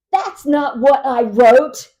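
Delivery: loud, slow, offended protest; indignant objection. Retold or imitated. Guest objecting to how her rating was read, loud and slow. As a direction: stretch every word in outrage.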